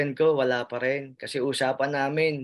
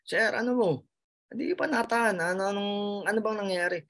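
imitating a puzzled guard, higher; lightly voiced guard asking what happened; morning-shift guard asking why he's still crying, lightly voiced; curious guard; 7 semitones higher, faster than usual.

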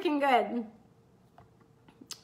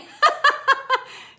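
high, loud burst of laughter; delighted laugh; 12 semitones higher, 8 dB louder than usual. Real, not acted. Coach laughs at being called security blanket, delighted. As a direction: crack up out loud. Laughs at client's kind words.

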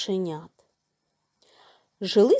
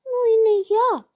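high, louder mouse voice; second little mouse; 12 semitones higher, 5 dB louder than usual. A narrator's reading. Second mouse refuses too, high and squeaky. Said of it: "каже й друге мишеня".

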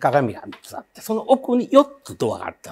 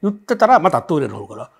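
ordinary talk

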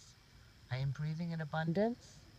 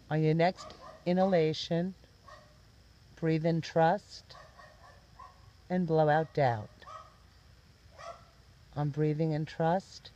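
guided meditation; 3 semitones higher, 9 dB louder than usual. Real, not acted. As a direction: guide them gently, phrase by phrase, lifting the ends before each release.

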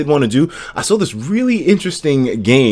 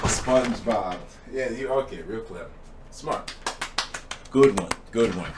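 much softer, dry sarcasm; sarcastic; 2 semitones lower, 13 dB quieter than usual. Real, not acted. Streamer answering a chat joke, dry and sarcastic. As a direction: mutter a deadpan "good one".

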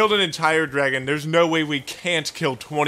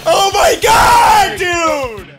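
high, loud, drawn-out yell; dismayed scream; 6 dB louder, much slower than usual. Real, not acted. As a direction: wail it out in defeat. Losing to the boss.

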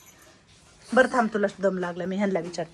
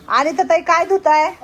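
raised, high-pitched questioning call; 6 semitones higher, 8 dB louder than usual. Real, not acted. Carer calls to a woman at her washing, calling out. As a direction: call over loudly to someone busy.

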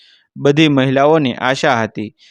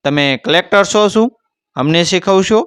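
higher-pitched, sharply challenging questions; mildly heated rebuke, rising at the end; stern; 6 semitones higher than usual. A narrator's reading. Official rebuking a village woman who begs for wages, stern. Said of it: "દેસાઈનો સ્વર કડક થઈ ગયો".

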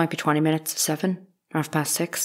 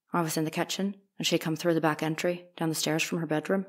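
dropping her voice; 6 dB quieter than usual. Acted. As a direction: let the words fall away quietly. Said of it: "Keep your voice up a little. You are lowering it".

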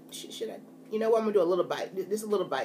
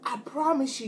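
ordinary delivery

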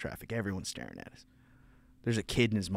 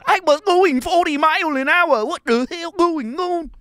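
mock Vietnamese accent; 12 dB louder than usual. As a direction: play how the vet imagines him talking. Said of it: "that's how I'm talking to him".

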